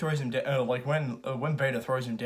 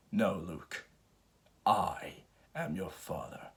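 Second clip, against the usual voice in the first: Darth Vader; 7 semitones lower, 7 dB quieter, slower than usual. Retold or imitated. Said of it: "Darth Vader is just like".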